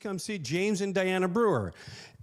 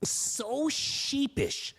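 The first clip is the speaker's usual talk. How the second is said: drawn-out sheepish; 7 semitones higher, much slower than usual. Retold or imitated. Slow exaggerated repeat.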